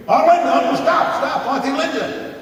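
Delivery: put-on high, loud frantic child's scream; kids screaming. Kids in the back seat screaming at Aunt Linda, high and loud. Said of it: "They started screaming".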